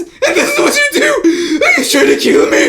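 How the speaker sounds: very high, loud cry; strangled shout. Acted. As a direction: yell out while being squeezed. Crushed in a hug.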